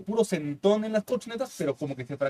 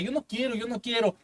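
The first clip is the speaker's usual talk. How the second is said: high, softer mock whimper of a frightened girl; scared girl; 6 semitones higher, 6 dB quieter than usual. Girl at the zip line refusing to jump, mock scared whimper. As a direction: plead like you're too scared to jump. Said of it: "tenía mucho miedo".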